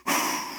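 whooshing breath of wind; airy mimicked whoosh; imitated wind. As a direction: blow air out like a passing gust. Imitates the wind blowing past.

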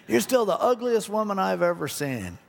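high, taunting talking-bird voice; parrot voice. Retold or imitated. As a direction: draw out the insult like a stubborn bird. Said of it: "He goes".

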